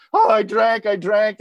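blubbering. Retold or imitated. At the meeting, confessing to the first person he talks to: blubbering. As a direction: blurt it out like you're half crying, mock-tearful. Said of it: "I'm, like, blubbering".

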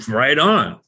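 punchy, exclaimed agreement; eager cheer. Retold or imitated. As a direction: burst out in glad approval. Retold reaction to ex-wife's invitation.

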